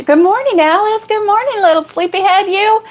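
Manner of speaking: high, sing-song good morning; sing-song greeting. Real, not acted. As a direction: coo a greeting to a friend. Greeting a viewer tenderly.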